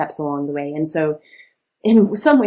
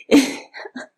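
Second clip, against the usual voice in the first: stifled laugh; 9 semitones higher, 5 dB louder than usual. Real, not acted. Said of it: "couldn't even do that with a straight face".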